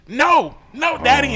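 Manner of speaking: put-on high, loud whiny kid voice; a child pushing the plate away with a high, loud no; whiny little daughter refusing her dad's food; little girl refusing.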